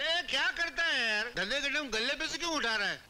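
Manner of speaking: high-pitched, indignant objection; annoyed protest. Acted. Beggar pulled away during business hours, indignant high protest.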